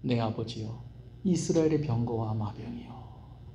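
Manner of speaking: soft, slow mournful cry; a touch of tearful grief; weeping lament. Retold or imitated. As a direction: mourn it quietly as the weeping king. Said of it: "울면서".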